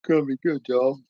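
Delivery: big loud hype call to the chat; hyped shout. Real, not acted. Streamer hyping his viewers, loud excited shout. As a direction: belt it out like opening a show.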